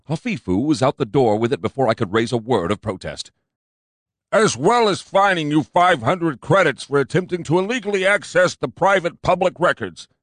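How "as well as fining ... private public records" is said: higher, louder pompous cop; fat cop insisting. A narrator's reading. Fat cop insisting to his partner, pompous.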